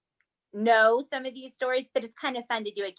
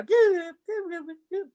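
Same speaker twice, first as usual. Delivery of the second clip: higher playful bloop noises; time warp bloops; 7 semitones higher than usual. Acted. Host doing sound effect to the seventies, playful. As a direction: make a silly travel sound. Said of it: "time travel sound effect".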